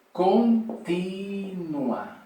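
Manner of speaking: Continua stretched out very slowly and held; slow emphasis. Shown as a demonstration. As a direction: draw out Continua to stress it. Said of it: "de una manera continua".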